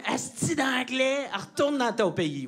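slow, drawled Quebec French insult; Québécois français, insulte lente; angry Québécois slur. Retold or imitated. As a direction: spit it out like an angry local. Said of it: "he went".

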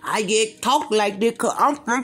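slurred, exaggerated Southern twang; mock Southern talk. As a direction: imitate garbled Florida and Georgia talk. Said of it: "They don't talk like that".

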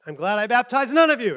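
mock-furious Paul; angry Paul. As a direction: snap it at the church like a fed-up apostle. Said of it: "Paul gets really mad".

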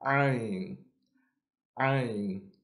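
Ain said sharp and deep from the inner throat; Arabic Ain from the throat. Shown as a demonstration. Teacher models correct Ain. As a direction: press Ain from the back of the throat. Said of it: "We say".

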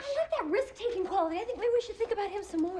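high-pitched, hesitant pleading; anxious wavering. Acted. Wife wavers over choosing a donor.

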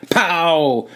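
sudden punchy shout; loud. A narrator's reading. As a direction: bark the sound of a punch. Narrator voices the punch.

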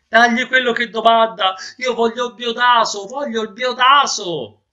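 high, stuffed-nose plea; noseless man pleading. Acted. Master tells maid to pay anything, pleading high. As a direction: insist loudly with a blocked nose.

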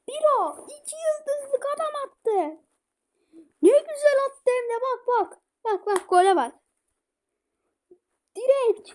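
high, loud goal praise; excited celebration. Real, not acted. Gamer celebrating Biro's goal, loud excited praise. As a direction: cheer the goal like a fan.

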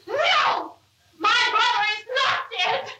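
shouting the news away; hysterical denial. Real, not acted. Denying her brother is dead.

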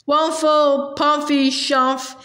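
words smothered and muffled, as if through a crushed hat; muffled from inside a hat. A narrator's reading. Uncle Andrew mumbling to the policeman from inside his bashed hat, muffled. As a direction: speak into a hat pressed over your face. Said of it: "came Uncle Andrew's voice from inside the hat".

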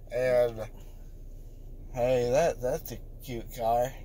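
low, soft, slurred mumbling; drowsy mumble. Real, not acted. Drowsy mumbling after anesthesia.

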